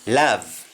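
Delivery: "Love" said with an open "ah" vowel, lav; English-style "Love", vowel like "ah"; English pronunciation. Shown as a demonstration. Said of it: "Pronounced in English".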